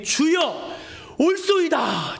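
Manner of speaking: high, fervent calling out to the Lord; pleading acted cry, pitched high. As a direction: cry out in earnest agreement, then let it fall. Acting woman's plea to Jesus.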